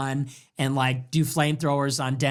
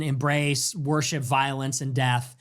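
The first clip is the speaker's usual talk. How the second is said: normal talking voice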